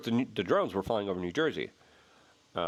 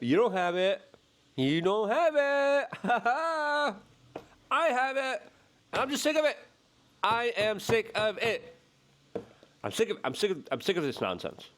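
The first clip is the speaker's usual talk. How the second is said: fed up rant; 9 semitones higher than usual. Real, not acted. Ranting at government teases.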